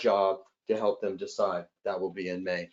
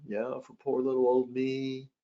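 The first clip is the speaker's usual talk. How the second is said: sweet pleading guest; 4 dB quieter than usual. Retold or imitated. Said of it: "some of them are nice".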